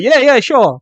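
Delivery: high, loud, brushing-off agreement; imitating Dana. Boss answering a reporter, high loud mock agreement. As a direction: blurt an over-eager mock assent. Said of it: "Dana was like".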